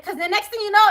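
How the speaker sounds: ordinary talk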